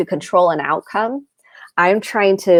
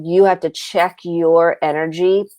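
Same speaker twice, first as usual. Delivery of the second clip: normal talking voice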